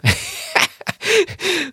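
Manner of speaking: sudden high-pitched cackling laugh; high cackle. Real, not acted. Podcaster laughing at his own cruel joke, high cackle. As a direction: burst out in a shrill cackle at yourself.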